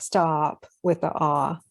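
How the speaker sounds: normal talking voice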